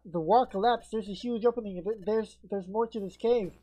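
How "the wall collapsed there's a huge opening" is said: ordinary talk, nothing marked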